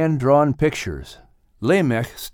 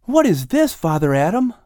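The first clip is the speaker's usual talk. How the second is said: put-on high, eager child's question; a boy pointing at a drawing; young Lamech asking Adam about a drawing, high and curious; 7 semitones higher, 4 dB louder than usual. A narrator's reading. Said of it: "Lamech asked".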